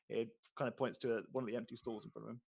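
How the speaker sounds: ordinary talk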